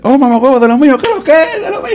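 high, laughing, teasing banter; playful insult among friends. Retold or imitated. Friend jokingly greeting a buddy with an insult, high and laughing. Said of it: "y dices".

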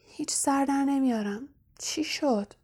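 shaken, half-voiced whisper. Harry asking his friends, in a shaken whisper. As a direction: breathe the question out.

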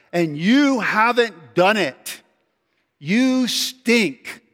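slow, high, pompous stump speech; campaigning politician. Retold or imitated. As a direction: accuse him like a rival candidate on the campaign trail. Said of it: "a speech of any politician".

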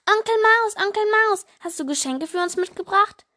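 put-on high, loud eager kids' voices; excited children calling. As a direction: call out like kids wanting presents. Mouse children greet uncle. (aufgeregte Kinderstimmen, hoch und laut)

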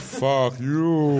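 a lightly voiced jeering crowd; the whole internet. Writer voicing the internet mocking him back. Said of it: "the whole internet goes".

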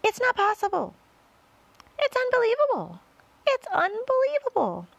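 very high, loud disbelief; astonished cry. A narrator's reading. Creatures crying out in disbelief at America. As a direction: gasp out the disbelief. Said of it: "they cried".

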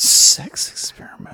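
gossipy whisper, half joking; mock whisper. Friend whispering back a shocking rumor, mock gossipy whisper. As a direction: lean in and whisper it like juicy gossip.

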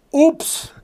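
high, loud, incredulous oops; outraged mock repeat. Patient repeating the doctor's answer, loudly incredulous. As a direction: shout the oops back in disbelief.

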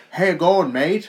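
high, loud hammed-up Australian "How you going, mate?"; Australian accent, mocking; mock Aussie greeting. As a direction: throw out a cheeky Australian hello. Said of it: "He goes".

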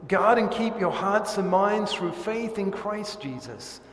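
loud, ringing pronounced blessing; solemn formal blessing; benediction. Acted. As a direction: bless the standing congregation. Pastor gives the benediction.

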